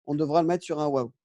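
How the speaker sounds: usual speaking voice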